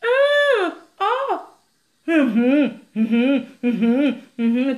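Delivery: hammed-up whimpering moans; crying to excite. Shown as a demonstration. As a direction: demonstrate the sexy crying moan. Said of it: "Il y a les styles de pleurs pour exciter".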